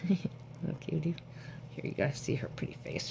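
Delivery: breathy hush to viewers; hushed whisper. Real, not acted. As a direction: whisper so the cat stays. Whispering while showing cat.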